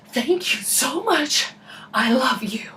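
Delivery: high, slow, breathy gratitude; gushing thanks. Retold or imitated. Lost driver thanking camp friend, gushing thanks. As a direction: gush with exaggerated relief.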